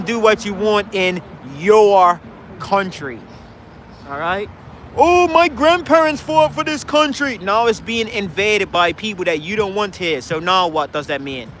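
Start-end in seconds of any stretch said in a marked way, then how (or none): 5.0-7.4 mock patriot. Mocking anti-immigrant Brits.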